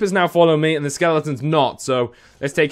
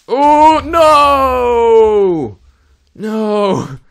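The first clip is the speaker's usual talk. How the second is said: loud, high anguished cry; dismayed shout; 7 semitones higher, 7 dB louder, much slower than usual. Real, not acted. Player watching the creeper explode, anguished shout. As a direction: shout as it blows up.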